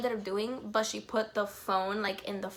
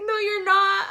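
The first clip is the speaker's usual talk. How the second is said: much higher and louder playful no; giddy teasing; 10 semitones higher, 9 dB louder than usual. Retold or imitated. Fan teases idol he won't see her, high giddy voice. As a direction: tease him while barely holding it together.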